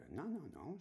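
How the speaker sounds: high protesting no no no; a little boy digging in his heels; child refusing. Retold or imitated. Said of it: "je me dis ça dans ma tête".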